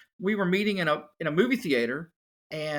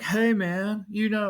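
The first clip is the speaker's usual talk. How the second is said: laid-back bodybuilder; 4 semitones higher, slower than usual. Retold or imitated. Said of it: "he kinda talks like that".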